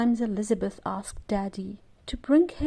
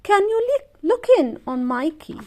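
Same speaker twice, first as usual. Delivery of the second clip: calling out; 9 semitones higher, 6 dB louder than usual. A narrator's reading. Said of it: "called Mommy".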